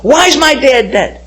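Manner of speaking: high, drawn-out retold plea; crying out. Grieving son asks God about his father, imitated crying out. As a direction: imitate an anguished cry of "why". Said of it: "I kept crying out to God".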